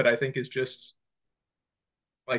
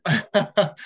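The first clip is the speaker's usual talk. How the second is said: low resonant voice; 4 dB louder than usual. Shown as a demonstration. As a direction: drop into a lower throaty voice, richer and smoother. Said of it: "yeah, it was kinda like this".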